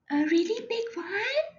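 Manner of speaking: lightly voiced hopeful crayon; a hint of an eager little crayon; eager character question.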